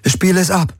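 short, high-pitched demand. A narrator's reading. Woman orders the ship to play the signal, demanding. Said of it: "forderte Kirai".

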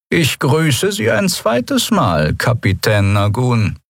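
slow, dry officer's greeting, high. Acted. Captain Quudal greets Captain Nagun, dry and slow. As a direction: greet her dryly, taking time. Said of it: "trocken, jedoch nicht ohne Sympathie".

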